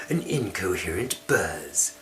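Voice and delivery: plain reading voice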